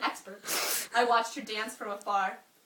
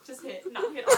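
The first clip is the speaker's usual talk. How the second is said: laughing; 12 semitones higher, 8 dB louder than usual. Real, not acted. Giggly clarification mid-reading.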